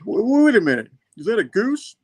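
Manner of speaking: hammed-up double take; mock surprised villain. Doc Ock spotting the goose, high mock surprise. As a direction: pitch jumps high in mock disbelief.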